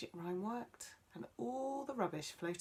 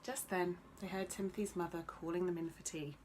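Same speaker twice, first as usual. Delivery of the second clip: usual speaking voice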